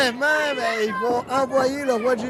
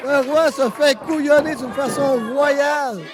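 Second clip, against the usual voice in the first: wrestling commentator; 5 semitones higher than usual. Acted. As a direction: build the hype for the big move. Live wrestling play-by-play. (commentateur de catch qui s'emballe)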